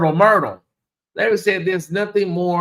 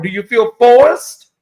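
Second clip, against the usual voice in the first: mock dramatic question; 6 semitones higher, 8 dB louder, slower than usual. Teasing viewers about donating.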